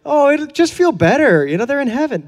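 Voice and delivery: high, sing-song mock consolation voice; mock comforter. Preacher mocking what friends tell the grieving, high sing-song. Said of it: "don't try to tell them".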